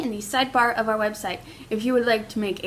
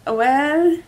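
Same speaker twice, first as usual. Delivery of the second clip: hesitant, slow and modest; captain pretending to be a French legionnaire, shy and slow; reluctant captain; 5 semitones higher, 12 dB louder, much slower than usual. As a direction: drag out a bashful reluctance. [name] plays a reluctant legionnaire.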